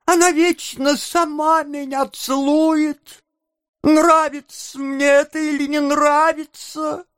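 high-pitched whining complaint; whimpering. A narrator's reading. Noah blaming Charlotte to the beadle, high whimper. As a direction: whimper and shift the blame in a high voice. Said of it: "захныкал Ноэ".

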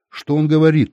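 plain reading voice

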